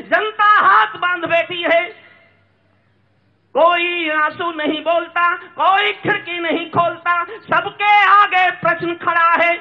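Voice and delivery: high, ringing declamation; impassioned verse. Acted. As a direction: cry each line out to the crowd.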